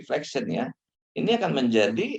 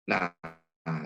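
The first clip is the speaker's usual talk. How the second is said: ordinary delivery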